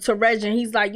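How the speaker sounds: normal talking voice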